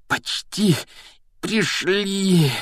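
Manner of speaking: high, strained, out of breath; heavy exhale. A narrator's reading. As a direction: puff it out under a heavy load. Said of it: "тяжело выдохнул Гарри". (на тяжёлом выдохе, с натугой)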